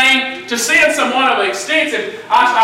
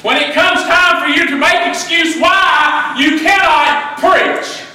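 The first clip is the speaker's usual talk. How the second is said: full-force preaching; 7 semitones higher, slower than usual. Real, not acted. Preacher rebuking the congregation's excuses, rising shout. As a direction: drive it home to the church like a fire sermon.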